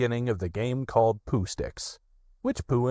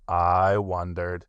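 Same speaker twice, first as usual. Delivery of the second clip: low, very slow, glum donkey; gloomy Eeyore; 5 semitones lower, much slower than usual. A narrator's reading. Eeyore answers Roo from the river, low, slow and gloomy. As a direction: drag it out unimpressed.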